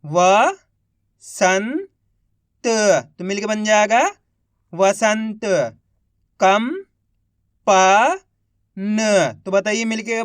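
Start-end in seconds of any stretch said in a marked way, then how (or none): 0.0-3.1 each syllable drawn out very slowly; slow syllable-by-syllable reading. Shown as a demonstration. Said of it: "एक अक्षर का उच्चारण करके". 6.4-9.3 each syllable drawn out very slowly; slow syllable-by-syllable reading. Shown as a demonstration. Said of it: "एक अक्षर का उच्चारण करके".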